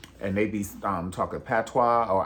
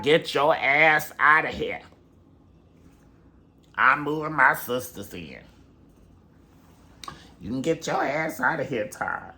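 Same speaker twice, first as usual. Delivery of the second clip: raised, loud, ordering voice; Mama Joy; 5 semitones higher, 6 dB louder than usual. Acted. Mother kicking Todd out as her daughters move in, loud and commanding. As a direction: throw the family out, loud and higher. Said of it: "Mama Joy's gonna be like".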